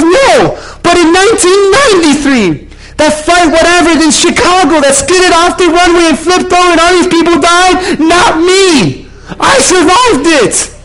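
very high, loud, breakneck-intense retelling; mock frantic shout. Passenger tells pastor of second crash, frantic shout. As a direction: imitate a worked-up survivor, shouting high.

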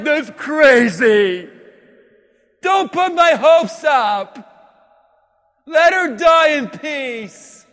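loud, slow, drawn-out objection; acted widow crying out against false hope; protesting. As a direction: stretch each word in a shouted protest.

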